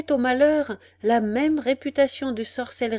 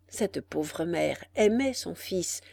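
usual speaking voice

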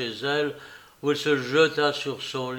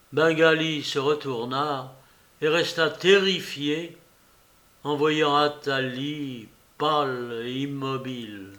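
usual speaking voice

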